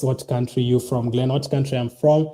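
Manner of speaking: ordinary talk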